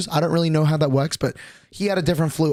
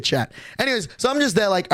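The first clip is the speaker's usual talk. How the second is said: ordinary talk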